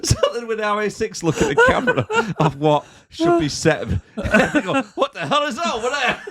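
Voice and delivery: high-pitched, laughing retelling; cracking up. Real, not acted. Telling a mate about MI6 watching, cracking up. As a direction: squeal it out through laughter. Cracking up over the film scene.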